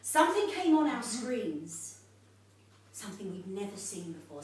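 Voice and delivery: ordinary delivery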